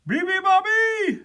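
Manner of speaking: high, loud animal shriek; piercing high call. A narrator's reading. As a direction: shriek it like a seal. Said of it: "shrieked the seals".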